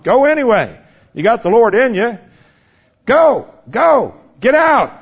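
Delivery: high, forceful preacher's Go; fervent repeated command; urgent command. Acted. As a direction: drive them out the door. Exhorting the congregation.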